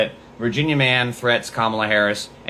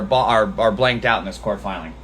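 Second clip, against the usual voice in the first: ordinary delivery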